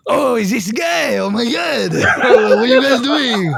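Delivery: high, drawn-out comic character voice; older coworker impression. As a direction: exclaim it as your excitable coworker, stretching words. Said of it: "that was, like, the way that he spoke to us".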